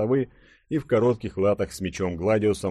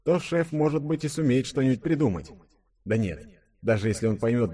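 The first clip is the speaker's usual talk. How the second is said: higher-pitched musing to oneself; inner thought; 4 semitones higher than usual. A narrator's reading. Denis weighs up their chances of rescue, thinking to himself. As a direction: think it through in a raised inner voice. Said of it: "подумал Денис".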